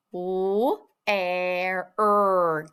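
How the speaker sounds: "W, air, er" as three separate drawn-out sounds. Shown as a demonstration. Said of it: "tap out the sounds in the word".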